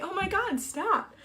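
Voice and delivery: pleading with herself to stop crying; tearful. Real, not acted. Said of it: "I didn't intend to cry".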